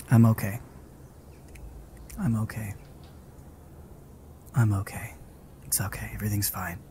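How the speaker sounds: soft, low whisper to calm down; shaken self-soothing whisper. Real, not acted. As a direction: whisper it to steady your nerves. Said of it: "calming himself after scare".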